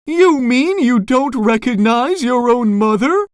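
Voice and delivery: high-pitched, affronted mother; Missus Puff surprised. A narrator's reading. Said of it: "Missus Puff said in surprise".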